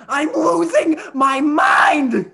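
loud, low, heavily drawn-out words; exasperated outburst. Acted. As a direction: stretch every word out in loud exasperation. Exasperated comeback to host's question.

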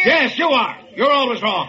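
high-pitched furious snap; angry shouting. Acted. Editor turning on the office boy, shouting. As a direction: take your temper out on the intruder. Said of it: "You shouted at him".

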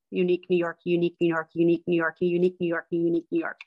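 "unique New York" repeated in even rhythm; family warm-up drill, repeated steadily; tongue twister drill. Shown as a demonstration. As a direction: chant "unique New York" five times clearly. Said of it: "to say unique New York three, five times".

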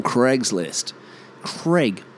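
CRAIG as "Creg"; short E in Craig and Craigslist; Cregs-list, Creg; American pronunciation. Shown as a demonstration. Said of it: "they'll say Craig".

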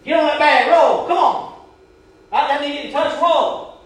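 loud, high shouted commands; drill sergeant shout. Retold or imitated. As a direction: drive them on like a drill sergeant. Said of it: "like a drill sergeant".

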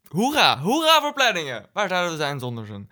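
loud, high hooray swooping down; mock cheering. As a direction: cheer it out in playful over-the-top celebration. Mock cheer for having a schedule.

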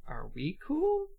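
slow, high-rising timid question; tentative. Acted. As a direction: check in hesitantly, pitch lifting. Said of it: "gives a tentative wave to Becky".

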